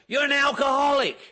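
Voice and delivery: loud, blunt accusation; shouted accusation. Retold or imitated. Man in his face at a meeting shouting the accusation. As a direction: bark it right in his face.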